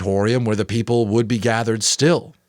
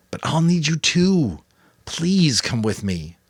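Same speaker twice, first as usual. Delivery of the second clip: pleading; 7 semitones higher, slower than usual. A narrator's reading. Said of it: "the final plea".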